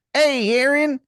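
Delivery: very high-pitched, hammed-up Maryland accent; Wizard of Baltimore, awful Maryland accent. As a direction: squeal it in the worst Maryland accent. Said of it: "he has the worst Maryland accent".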